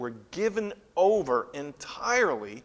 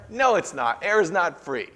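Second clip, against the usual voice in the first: louder, higher indignant no; cranky protest; 6 semitones higher, 7 dB louder than usual. Retold or imitated. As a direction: grumble like a cranky old person. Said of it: "And I go".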